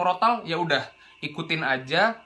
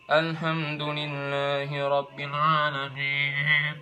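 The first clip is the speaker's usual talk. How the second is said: slow, softer "Alhamdulillahi rabbil alamin" sent through the nose; nasal voice; 2 semitones lower, 4 dB quieter, much slower than usual. Shown as a demonstration. Teacher demonstrates the wrong nasal recitation voice. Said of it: "Kalau misalnya pakai suara hidung".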